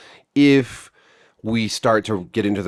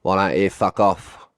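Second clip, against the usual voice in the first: broad, hammed-up Australian impression; bad Australian accent; slower than usual. Vlogger mocking the Australian censors, bad Australian accent. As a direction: put on a shaky-at-best Aussie accent. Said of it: "I'm terrible at Australian accents".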